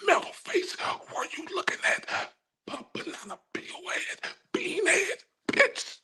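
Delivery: high playground mockery; squeaky mocking taunt. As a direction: jeer at her in a squeaky voice.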